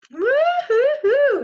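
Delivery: high, loud joyful whoop; excited whoop. Acted. Teacher cheering about a restful sleep, whooping high and loud. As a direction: cheer it out like a celebration. Celebrating waking up refreshed.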